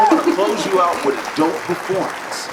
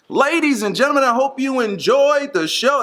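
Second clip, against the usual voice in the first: booming ring announcer voice, higher; wrestling promo; 5 semitones higher than usual. Pretend. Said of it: "That's my wrestling voice".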